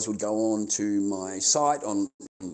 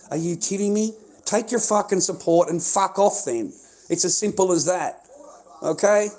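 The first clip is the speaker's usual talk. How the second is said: raised, heated rant at his own supporters; angry outburst; 5 semitones higher, 3 dB louder than usual. Real, not acted. Streamer telling his supporters to back off, angry and raised. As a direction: snap back at them, fed up.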